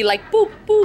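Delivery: high electronic boops; high beeping. Acted. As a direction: mimic a blinking map dot. Imitating map beeps.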